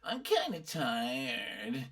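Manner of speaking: put-on whiny, creaky East Coast college girl; bored, whiny spoiled-girl drawl. Shown as a demonstration. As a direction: drawl lazily with twang and fry. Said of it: "spoiled college girl voice".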